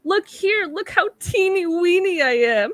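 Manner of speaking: high, begging little voice; plead, make yourself sound tiny. Retold or imitated. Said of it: "It's like".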